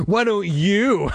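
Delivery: slow, high taunting comeback; childish drawn-out sibling jab; retort. A narrator's reading. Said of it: "He predictably shot back".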